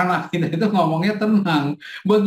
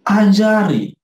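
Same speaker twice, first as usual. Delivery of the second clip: Ajari said louder, falling firmly; emphatic ajari; 4 dB louder, slower than usual. Shown as a demonstration. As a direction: hammer the word Ajari. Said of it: "repeats Ajari for emphasis".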